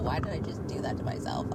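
breathy whisper, scolding herself; whispered self-scolding. Real, not acted. After missing the turn, whispering at herself. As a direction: hiss it to yourself in frustration.

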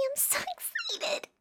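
giddy laugh breaking the words; giggling. Acted. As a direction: squeal through the giggles. Giddy over beach trip.

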